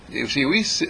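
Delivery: ordinary delivery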